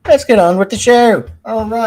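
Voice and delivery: loud, high hyped-up call; hammed-up rallying yell; mock showman shout. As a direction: belt it out like a show opener.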